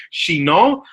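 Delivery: Chinon as French "shee-NOH"; best French accent. Shown as a demonstration. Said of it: "in my best French accent".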